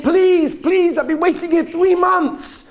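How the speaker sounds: begging. Acted. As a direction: plead hard, pitch up, three months of waiting in it. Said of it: "She's begging him".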